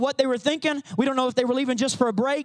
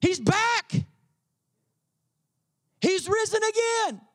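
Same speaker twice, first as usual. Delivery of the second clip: higher, slow, awed recognition; amazed realization; 10 semitones higher, slower than usual. Retold or imitated. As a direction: Peter realizing his Lord is back. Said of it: "he realizes".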